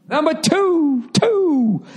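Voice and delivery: giddy high-pitched glee over a second cheesesteak; excited squeal. Real, not acted. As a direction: squeal "two" way up high and slow.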